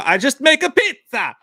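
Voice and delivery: high, loud mock Italian accent; exaggerated pizza-maker Italian; bad accent. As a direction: burst into a cartoon Italian accent. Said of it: "that's how I do accents".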